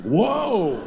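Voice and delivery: loud, high-pitched alarmed outburst; mock shock. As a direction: cry out in exaggerated disbelief, voice shooting up. Comic shocked reaction to Rick's story.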